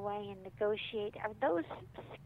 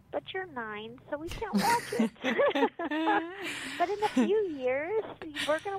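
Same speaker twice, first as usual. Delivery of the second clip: playful firm limit; 6 semitones higher, 5 dB louder than usual. Acted. Acted parent setting limit.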